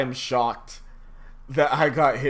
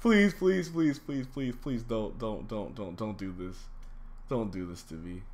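pleading, fading to a mumble. Real, not acted. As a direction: plead until you give up. Begging the game, fading out.

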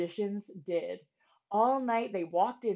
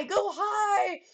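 "go high" pitched even higher, shouted; rising shout; 10 semitones higher, 7 dB louder than usual. Acted. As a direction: push "go high" still further up. Magicians urge the smoke upward.

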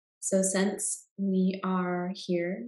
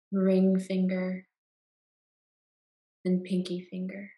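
guided meditation. Acted. Guide leading listeners into Yoga Nidra rest, calm meditation voice.

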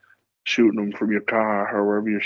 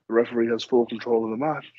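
ordinary delivery

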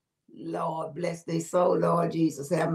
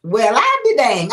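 slow, louder I be dang; astonished; 4 semitones lower, 9 dB louder than usual. Real, not acted. Host stunned that the sub was untested, slow louder exclamation. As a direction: let the disbelief land.

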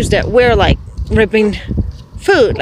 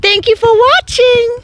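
high sing-song coaxing; 14 semitones higher than usual. Real, not acted. Owner coaxing her goose to say bye, high and sing-song. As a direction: coax the goose into a goodbye.

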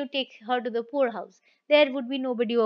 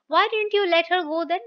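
indignant question; 5 semitones higher, 5 dB louder than usual. Retold or imitated. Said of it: "she says".

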